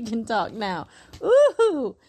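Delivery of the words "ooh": high, loud teasing ooh; playful ooh. Real, not acted. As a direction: tease her for being feisty. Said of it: "my little niece is getting feisty".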